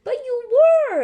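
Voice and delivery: insistent, stressing were; a child insisting, loud and high. Acted. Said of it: "insists Susie".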